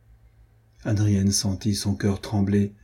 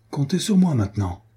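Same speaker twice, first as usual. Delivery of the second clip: exclaiming; 4 semitones higher than usual. A narrator's reading. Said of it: "s'écria Jean de Werth".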